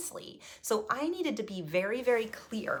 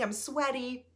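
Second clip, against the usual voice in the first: ordinary talk, nothing marked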